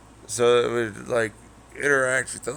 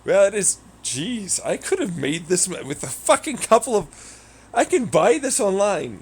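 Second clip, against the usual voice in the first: excited; 9 semitones higher, 6 dB louder than usual. Real, not acted. High excited rant.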